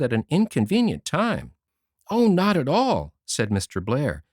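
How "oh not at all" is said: louder, higher strained politeness; forced polite smile. A narrator's reading. Mr Blair covering his fluster for the girls, forced cheer. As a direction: smile through the embarrassment. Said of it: "trying to smile".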